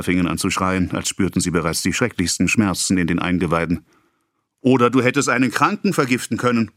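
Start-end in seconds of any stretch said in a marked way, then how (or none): 4.6-6.7 higher accusing; ongoing rebuke. A narrator's reading. Pharmacist continuing to scold, higher. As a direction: keep scolding. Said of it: "fuhr der Apotheker fort".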